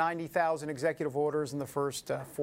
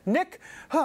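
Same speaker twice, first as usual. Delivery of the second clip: breathless, puffing after rushing; out of breath with a gasp; 9 semitones higher, 4 dB louder than usual. Real, not acted. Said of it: "I'm out of breath".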